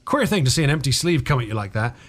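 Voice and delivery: usual speaking voice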